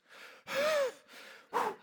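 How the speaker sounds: high, long sigh of relief. Retold or imitated. Pastor finally getting his name out, sighing with relief. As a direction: let it all out in a relieved sigh.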